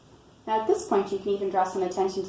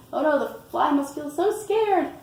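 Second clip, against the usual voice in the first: higher, louder, playful dismay; mock alarm; 8 semitones higher, 5 dB louder than usual. Parent talks to child about the fly's feelings, mock alarm. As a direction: exclaim it with child-directed concern. Voicing sympathy for the fly.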